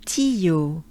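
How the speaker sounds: "Ti, yo" com foco no som do "i"; faça o "i" de "Ti" ouvir-se bem; focus on the e. Shown as a demonstration. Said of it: "focus on the E a little bit".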